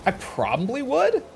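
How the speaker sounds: I probably would, lifted high and rising like a surprised question; high questioning rise. Real, not acted. Answering chat's question with a high rising maybe. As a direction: toss it up high, half asking. Chat asks about eating human meat.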